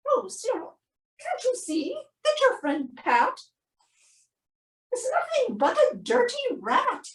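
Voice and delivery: high, soft, quick scolding refrain; disgusted mother. Acted. Mother scolding Cyril about his rat friend, high and fussy. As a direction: lilt it like a fussy mum.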